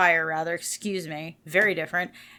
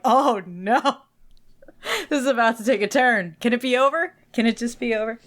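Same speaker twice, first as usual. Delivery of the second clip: higher, louder, rushed panic; playing up the horror of hearing herself; hammed-up oh-no panic; mock dread; 4 semitones higher, 5 dB louder than usual. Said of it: "I'm like".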